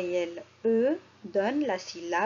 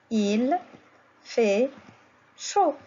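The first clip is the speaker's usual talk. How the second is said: "Il fait chaud" split into single words; much slower than usual. Shown as a demonstration. Teacher reads the sentence word by word for pupils. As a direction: read "Il fait chaud" one word at a time. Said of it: "Qu'est-ce que j'ai écrit?".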